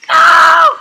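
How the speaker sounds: high loud scream of panic; panicked scream. Real, not acted. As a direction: shriek it out in alarm.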